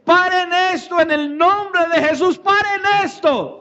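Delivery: high-pitched retold shout, urgent plea; impassioned cry. Preacher acting out a man shouting from the arena, impassioned high cry. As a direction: cry out to halt it, pitched well up. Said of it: "en alta voz empezó a gritar eufóricamente".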